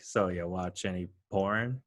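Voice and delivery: low, slow, lightly voiced cartoon impression; a hint of a slow, low character voice; Joe from Family Guy. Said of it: "where Joe's like".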